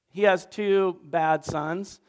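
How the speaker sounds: ordinary talk, nothing marked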